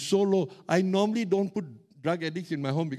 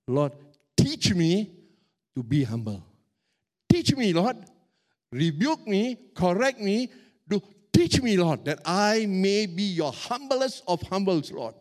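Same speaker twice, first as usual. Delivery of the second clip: rising, louder pleading prayer to the Lord; fervent prayer; 4 dB louder, slower than usual. Shown as a demonstration. Preacher showing the right prayer, loud fervent pleading. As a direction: pray it with growing force.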